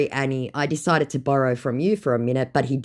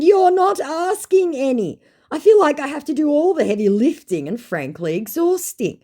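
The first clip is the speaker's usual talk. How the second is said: mock exasperated; 14 semitones higher, 6 dB louder than usual. Woman to scammer, theatrically exhausted. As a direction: sigh it out like a drama queen.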